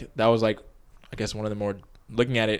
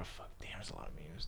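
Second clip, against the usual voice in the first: whispering; 4 semitones lower, 21 dB quieter than usual. Real, not acted. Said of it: "whispered aside".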